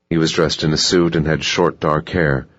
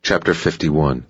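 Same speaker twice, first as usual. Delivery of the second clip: ordinary delivery, nothing marked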